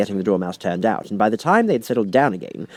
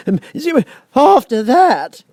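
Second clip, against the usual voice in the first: high, slow, whimpering; almost crying as he goes on; tearful Hatter; 9 dB louder, slower than usual. A narrator's reading. Hatter nervously continues his testimony, near tears.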